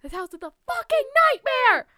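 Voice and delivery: high, loud screeching wail; mock shriek. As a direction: wail the line in hammed-up horror. Repeats line in mock shriek.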